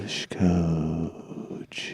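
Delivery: creepy whisper. Pretend.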